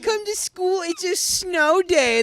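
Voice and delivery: high-pitched mimicking of a friend; mock pleading. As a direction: put on a high, drawn-out pester. Mimicking the snow-day demand.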